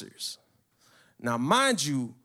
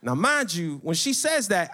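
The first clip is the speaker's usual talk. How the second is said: usual speaking voice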